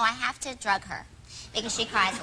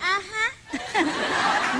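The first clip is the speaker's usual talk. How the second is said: laughing uh-huh; 7 semitones higher than usual. Retold or imitated. Laughing disbelief.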